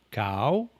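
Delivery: cow said with its pitch rising sharply; Thai rising tone. Shown as a demonstration. Teacher demonstrating the Thai rising tone, pronunciation. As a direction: glide cow upward like a question. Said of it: "Rising tone is".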